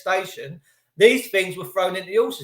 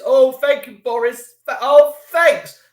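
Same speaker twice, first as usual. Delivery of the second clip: mock grateful; 6 semitones higher, 10 dB louder, slower than usual.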